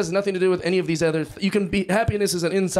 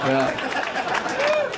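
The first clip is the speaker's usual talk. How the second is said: high sheepish yeah. Real, not acted. Sheepish admission.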